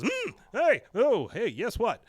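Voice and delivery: jumpy, high and loud hey of someone caught off guard; startled. Retold or imitated. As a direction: caught eavesdropping and jolted. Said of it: "Like, she's startled".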